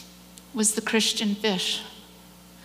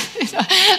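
real laugh; 9 semitones higher, 6 dB louder than usual. Real, not acted. Reacting to her own answer.

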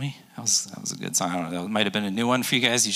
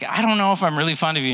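hurried Peter; 6 semitones higher, 3 dB louder, faster than usual. Retold or imitated. Peter voiced quickly.

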